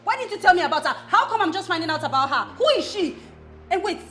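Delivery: loud, indignant demand for answers; angry confrontation. Acted. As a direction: fire off accusing questions loudly. Jealous confrontation over unknown woman.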